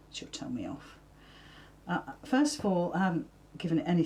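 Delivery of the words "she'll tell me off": low playful whisper; whispered aside. Real, not acted. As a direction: whisper it like a confession. Joking aside about Jackie.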